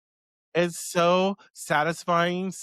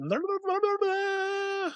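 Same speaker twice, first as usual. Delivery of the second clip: glitchy laugh; 3 dB quieter than usual. Real, not acted. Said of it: "I'm just short-circuiting".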